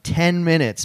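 usual speaking voice

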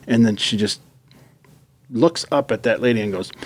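ordinary talk

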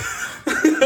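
a high, airy burst of laughter; wheezing giggle; high breathy laugh. Real, not acted. Laughing at weed whacker joke.

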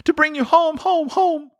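put-on very high, joyful child's voice; delighted little girl. A narrator's reading. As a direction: sing out the happy news like a child. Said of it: "bending down to laugh".

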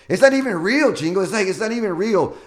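excited. Real, not acted. Excited about his story.

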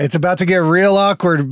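ordinary delivery

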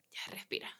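whispered self-talk; self-calming whisper. Retold or imitated. Waitress telling herself to calm down, in a whisper. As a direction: breathe out the words to calm yourself down.

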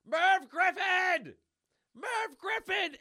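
high, slow, loud scream. Acted. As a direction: scream the name with all your lungs. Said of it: "they're screaming".

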